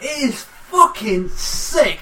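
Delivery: slow, drawn-out disgust; mock outrage at a sick advert. Real, not acted. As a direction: spit the words out slowly in disgust. Disgusted slow emphatic verdict.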